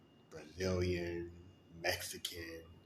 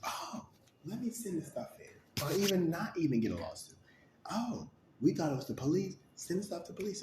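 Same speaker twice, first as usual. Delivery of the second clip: mock gossip voice; 9 semitones higher than usual. Imitating gossiping neighbors.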